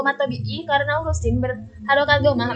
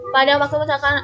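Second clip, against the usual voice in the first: normal talking voice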